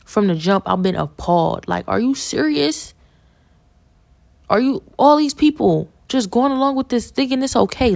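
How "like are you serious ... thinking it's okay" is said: pitched way up, louder, in disbelief; can't believe what she's seeing; incredulous outburst. Real, not acted. Appalled at people going along.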